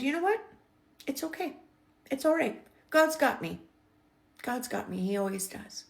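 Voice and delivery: high, choked-up reassurance; tearful. Real, not acted. Telling the agent God's got her, tearful and high. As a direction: voice lifts high, on the verge of tears. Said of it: "Why am I crying?".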